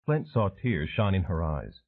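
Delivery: ordinary delivery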